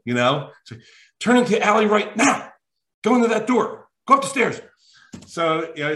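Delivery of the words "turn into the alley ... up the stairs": lightly voiced spy-movie handler, higher; Mission Impossible handler. Agent directing him through the airport like a spy film, higher. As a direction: bark orders like a movie handler. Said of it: "like, Mission Impossible".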